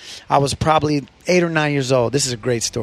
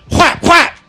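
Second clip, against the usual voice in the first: loud slap sound effect; 13 semitones higher, 9 dB louder than usual. Retold or imitated.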